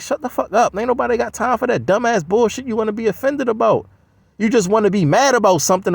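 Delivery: usual speaking voice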